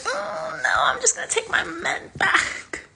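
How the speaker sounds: high, breathless whispered refusal; nervous whisper. Retold or imitated.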